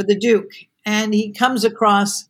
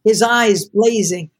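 usual speaking voice

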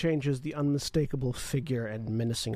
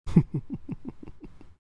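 villain laugh; 5 semitones higher than usual. Acted. Villain laughs after his gift.

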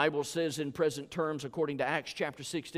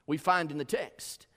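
ordinary delivery

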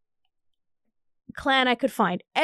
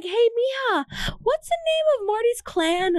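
higher excited mom voice; mom calling; 11 semitones higher than usual. Retold or imitated. Mom on the phone asks daughter, higher excited voice. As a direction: call out brightly like mom asking. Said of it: "she's like".

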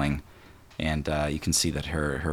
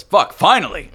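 high, loud yelp of relief; excited cry; 12 semitones higher, 11 dB louder than usual. Acted. A captive in the room cries out, loud and high. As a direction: shout it out with glee. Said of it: "One person in the room goes".

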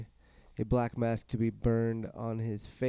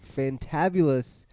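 usual speaking voice

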